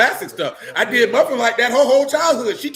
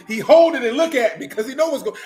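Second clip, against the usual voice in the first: ordinary delivery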